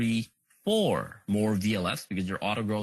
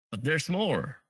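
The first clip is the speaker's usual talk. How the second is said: pitchman's "but there's more"; infomercial voice; 4 semitones higher than usual. Pretend. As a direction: sell it like a late-night TV ad. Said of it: "Started to sound like a, uh, infomercial".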